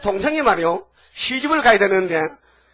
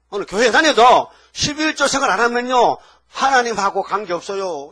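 forceful preaching; 2 semitones higher, 4 dB louder than usual. Acted. Preacher presses tithing, raised voice.

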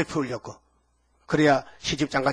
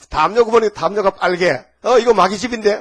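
alarmed discovery; 5 semitones higher, 7 dB louder than usual. Retold or imitated. Spotting a red blanket.

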